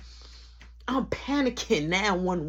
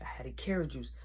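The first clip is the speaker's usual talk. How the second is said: hushed, conspiratorial whisper; 8 semitones lower, 13 dB quieter, faster than usual. Shown as a demonstration. Storyteller whispering the juice detail to the audience. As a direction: drop to a secret whisper on the repeat. Secretive repeat of the line.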